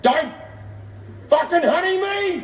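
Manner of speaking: high-pitched angry outburst, acted; steaming. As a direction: blow up at a loving greeting. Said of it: "came back just fucking steaming".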